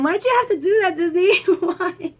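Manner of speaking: laughing plea. Real, not acted. Pleading with Disney over a death.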